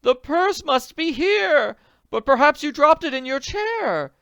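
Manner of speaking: high, loud, slow hopeful urging; hopeful suggestion. Acted. Potter suggests where bag is, hopeful. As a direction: urge hopefully. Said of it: "suggested hopefully".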